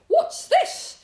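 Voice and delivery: very high, loud excited squeak; delighted squeak. A narrator's reading. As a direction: squeak with delight like a little rabbit. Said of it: "he squeaked with delight".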